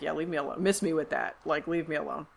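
a muttered leave me alone; dismissive mumble. Retold or imitated. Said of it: "Nicholas is just like".

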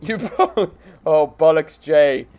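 high, loud hammed-up English accent; mock English accent. Complaining to Jay about these chaps, hammed-up English accent. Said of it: "like English, Australian, Irish".

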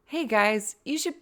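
high, coaxing mock-cheerful voice, half laughing; mock cheerleader. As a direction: egg them on in a high, hammed-up voice. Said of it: "I find myself in a position of being like".

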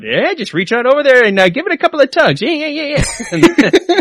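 high hammed-up leering radio jock; mock shock jock, high and playful; shock jock impression. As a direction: leer it out like a sleazy DJ. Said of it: "shock jock about".

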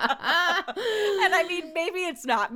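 high, loud words through laughter; laughing. Real, not acted. Co-host backtracking on the Costco jacket joke, laughing. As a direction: talk while still cracking up.

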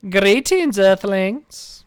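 loud, announcing alien greeting. Acted. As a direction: greet the earthlings like a sci-fi alien. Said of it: "What did that just remind me of?".

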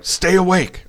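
mock-bark it up high like a friend keeping him conscious; friend's order. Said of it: "He's like".

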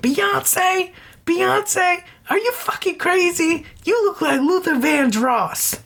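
hammed-up high-pitched diva outrage; campy outraged quote. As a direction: scream it like a scandalised diva. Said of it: "one-man show where he just quotes things".

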